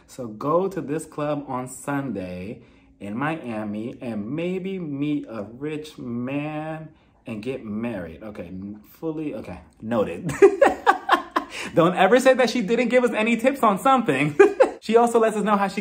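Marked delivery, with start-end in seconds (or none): 0.1-10.3 slow, low, hushed dictation to self; mock note-taking. Vlogger jotting down tips on finding a rich man, mock note-taking. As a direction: draw it out softly as if writing it down. Pretends to note down dating pointers.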